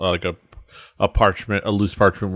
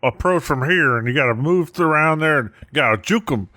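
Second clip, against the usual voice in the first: excitable, higher-pitched sports analyst patter; John Madden; 6 semitones higher than usual. Acted. Player telestrates a dungeon move for the group, as mock football commentary. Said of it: "The John Madden of D and D".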